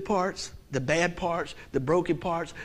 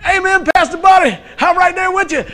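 loud high shout of agreement, laughing; shouted amen; 10 semitones higher, 9 dB louder than usual. Real, not acted. Congregant shouting support to Pastor Buddy, laughing.

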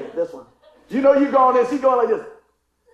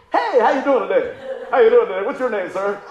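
loud, pouncing salesman greetings; mock eager salesmen; 7 dB louder than usual. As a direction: hail the customer like a salesman. Acts out pouncing car salesmen.

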